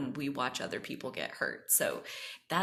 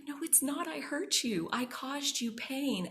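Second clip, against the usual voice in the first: insistent protest; 9 semitones higher than usual. Retold or imitated. Retold child's protest to grandma.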